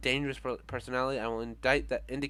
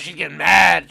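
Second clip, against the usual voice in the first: She's getting mad said much louder; louder mock alarm; 4 semitones higher, 15 dB louder than usual. Shown as a demonstration. Commenting on the arguing character, loud mock alarm. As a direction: raise the volume as if alarmed.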